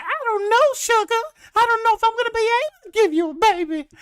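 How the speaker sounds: prim southern lady voice, way up high; southern belle. Retold or imitated. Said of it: "her southern accents".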